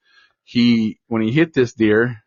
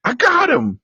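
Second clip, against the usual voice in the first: a high, yelled imitation of the boy's cry; excited shout. Son calling out from his stand after the shot, high excited cry. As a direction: cry it out like a kid who just hit his first buck.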